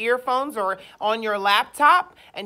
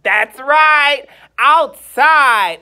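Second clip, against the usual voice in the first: loud and high, calling across a playground; outside voice; 3 semitones higher, 11 dB louder, much slower than usual. Shown as a demonstration. Teacher shows kids the loud outside voice. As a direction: show them the outside voice. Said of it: "where do we use our outside voice".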